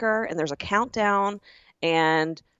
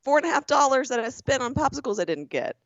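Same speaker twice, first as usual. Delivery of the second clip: high, rushed pleading; fast pleading; 8 semitones higher than usual. Retold or imitated. Customer rushing to calm the agent down, fast and high. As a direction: hurry to stop anyone being punished. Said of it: "I was like".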